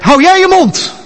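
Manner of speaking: high, loud shouted command; angry shout. Acted. Angel shouting at Satan the accuser, a furious shout. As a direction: shout him down hard. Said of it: "Hij schreeuwt naar hem".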